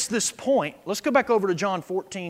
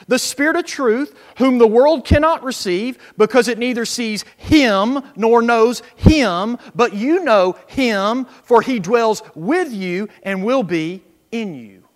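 loud, slow pulpit reading of John; preached Scripture reading; 3 semitones higher, 8 dB louder than usual. Acted. Preacher reading Jesus' words aloud to the church, loud and slow. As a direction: proclaim the verse to the congregation.

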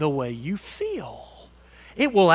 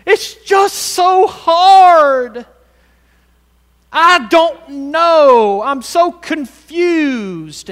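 drawn-out, loud mock whining of a hopeless believer; mock whine; 9 semitones higher, 12 dB louder, much slower than usual. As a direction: stretch each word like a self-pitying complainer.

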